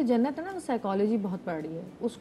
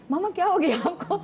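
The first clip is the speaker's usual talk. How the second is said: daughter's mock exasperation; 7 semitones higher than usual. Daughter protesting to mother.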